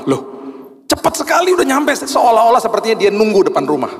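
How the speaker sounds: high, loud amazed exclaim; astonished that the car is already here; sudden high surprise; surprised. Retold or imitated. Surprised at quick car arrival.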